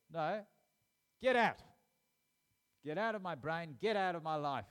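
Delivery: loud, high shout to banish an enemy; commanding rebuke. Acted. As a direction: drive the enemy out at full voice. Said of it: "speak out against it and bind the enemy".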